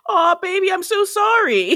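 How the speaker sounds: syrupy mock apology; fake apology. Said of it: "then be like".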